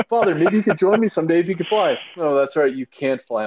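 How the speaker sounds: high, loud taunting voice, hammed up; mocking Mother. Fan voices Mother taunting Father about flying, high mocking. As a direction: taunt him like a cruel queen. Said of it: "And, like".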